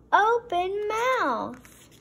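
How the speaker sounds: slow, loud, high gasp of alarm; dramatic reveal. A narrator's reading. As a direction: stretch it out in alarm.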